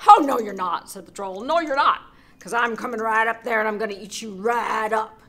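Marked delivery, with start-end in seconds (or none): 0.1-0.9 huge troll roar. Acted. Troll shouts at goat. 1.4-5.2 loud threatening troll voice; angry troll. Acted.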